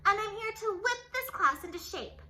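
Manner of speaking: loud scratchy commanding bark; stern witch teacher. A narrator's reading. Strict teacher barking orders at class, loud. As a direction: order the class around harshly. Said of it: "said Miss Swamp".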